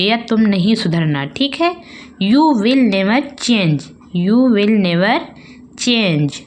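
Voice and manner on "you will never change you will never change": you will never change drawn out slowly, word by word; slow scolding demo. Shown as a demonstration. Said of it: "बच्चों को इस तरह से डांटते हैं ना". (धीमे से डांटते हुए)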